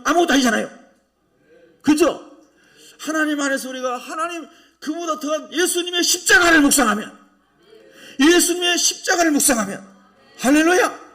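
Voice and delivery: loud, high-pitched sermon delivery; forceful preaching. Real, not acted. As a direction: preach at full force to the congregation.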